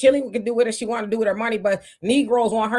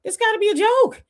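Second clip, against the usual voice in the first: higher, incredulous this gotta be a joke; disbelief; 10 semitones higher than usual. Retold or imitated. Host retelling his reaction to the clip, incredulous. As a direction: say it like you can't believe it. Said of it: "I was like".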